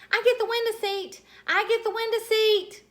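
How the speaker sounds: high, loud, thrilled shout; loud excited call. Acted. Junie B calling out for the window seat, loud and thrilled. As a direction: claim the seat at the top of your voice. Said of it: "I called real loud".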